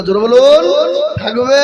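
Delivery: loud call for response; preacher urging loud reply. Real, not acted. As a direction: demand the crowd answer loudly.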